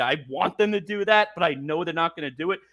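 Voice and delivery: ordinary talk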